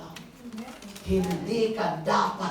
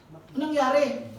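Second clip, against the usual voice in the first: higher, startled father asking; 6 semitones higher, 3 dB louder than usual. Retold or imitated. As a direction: ask like a worried father rushing in. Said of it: "Sabi niya".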